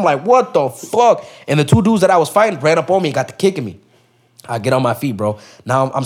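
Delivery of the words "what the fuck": high, drawn-out disbelief; retold shock. He falls back after the bottle hit, retold shock. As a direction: act out stunned outrage, pitched well up.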